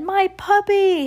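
high, drawn-out cry; little boy's alarmed shout; high, slow wail for his toy. A narrator's reading. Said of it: "Henry shouted".

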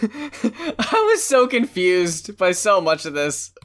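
slow, words through laughter; laughing. Real, not acted. Co-host confused by the album, laughing through it. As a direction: talk while cracking up. Cracking up at the song.

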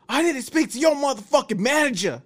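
high, loud mock angry customer; angry customer imitation; retold demand. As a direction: play the furious customer demanding the manager. Guy behind demands manager.

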